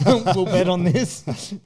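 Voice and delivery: giggling through the line; laughing. Real, not acted. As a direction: laugh the words out. Laughing while quoting coaches.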